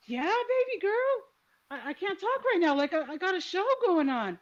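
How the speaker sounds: put-on high, cooing reply to a toddler; imitated grown-up humoring a child; imitated indulgent uncle. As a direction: talk sweetly up high to a little girl. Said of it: "And he's like".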